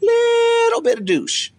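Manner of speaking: "Little" very high and drawn out, then falling through "bit of douche"; exaggerated stress. Wife's house phrase for husband, "Little" squealed high and stretched. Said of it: "you gotta say it like that".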